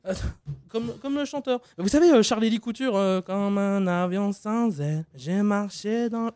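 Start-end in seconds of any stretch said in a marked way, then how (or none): 1.8-6.3 slow crooning mumble; a slowed, hammed-up imitation of the singer. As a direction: drawl the lyric out slowly. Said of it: "je voulais passer cette imitation".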